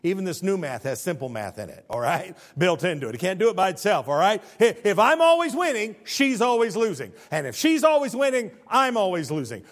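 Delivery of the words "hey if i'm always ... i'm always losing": loud, high, insistent delivery; emphatic preaching. Real, not acted. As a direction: hammer the point home from the pulpit. Preaches with raised voice.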